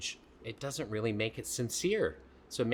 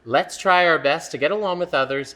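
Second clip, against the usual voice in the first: put-on reading to children; 4 semitones higher, 9 dB louder than usual. Reading a page aloud.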